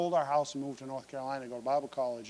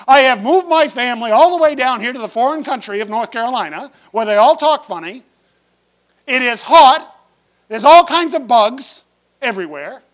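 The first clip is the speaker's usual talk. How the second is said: anguished complaint to God; 9 semitones higher, 17 dB louder than usual. Retold or imitated. Believer complaining to God about his hardships, loud anguished outcry. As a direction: cry out at God, hurt and accusing.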